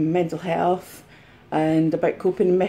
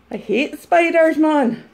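high, hammed-up whimpering complaint; distressed; 7 semitones higher, slower than usual. Reseller recovering from spider scare, high and upset. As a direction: still rattled, pitched up high. Aftermath of acted spider panic.

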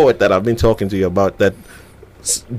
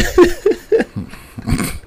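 laughing. Real, not acted. Host laughs at guest's reply.

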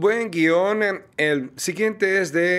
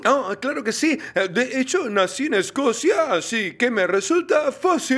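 higher-pitched, lilting Scottish accent; put-on Scottish accent, pitched up; 5 semitones higher than usual. Shown as a demonstration. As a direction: claim Scottish birth in a Scottish accent. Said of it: "puedes hacer un acento escocés".

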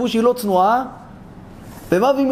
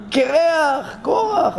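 high-pitched mocking name-call; teasing call; 7 semitones higher, much slower than usual. Onlookers mocking Korach's shaved head, teasing. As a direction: tease him with a raised call. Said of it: "התחילו לקרוא לו שמה".

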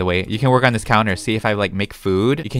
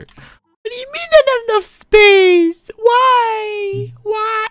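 much higher, loud, disbelieving yell; outraged shriek; 7 dB louder, much slower than usual. Real, not acted. Builder yells at the game over lack of space, high outraged shriek. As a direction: scream at the game for saying no space. Game refuses kitchen placement.

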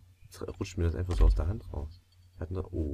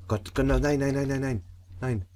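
panicked; 7 semitones higher, 3 dB louder than usual. Real, not acted. Panicked repeated no as the saw slips.

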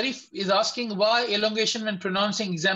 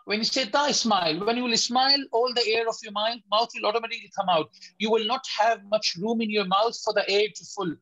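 ordinary talk, nothing marked

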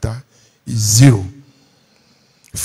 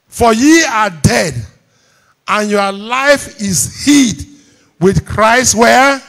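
loud, high, slow verse reading; raised preacher's voice, drawn-out words; declaimed scripture; 7 semitones higher, 6 dB louder, much slower than usual. Real, not acted. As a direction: proclaim it slowly to a congregation. Declaims verse, prompting congregation.

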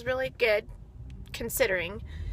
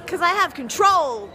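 smug; 5 semitones higher, 5 dB louder than usual. Real, not acted. Boasting about not spending money.

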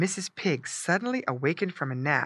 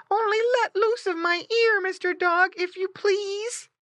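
high squeaky plea; pleading high piglet voice, slowing at the end; crying piglet; 4 dB louder than usual. A narrator's reading. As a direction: beg the dog as a little pig. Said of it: "cried Squinty".

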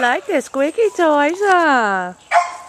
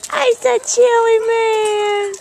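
pet talk; 7 semitones higher than usual. Real, not acted. Owner greets Chewy again in higher baby talk. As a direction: coo at the dog.

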